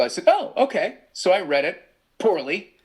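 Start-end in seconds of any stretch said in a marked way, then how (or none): 0.3-1.0 mock surprise. Retelling his reply to the director.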